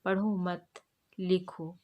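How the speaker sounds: पढ़ो मत as one group, pause, लिखो; pause after मत. Shown as a demonstration. Teacher shows the example that tells one to write, pausing after मत. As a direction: break clearly between मत and लिखो. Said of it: "लिखने को कहा जा रहा है".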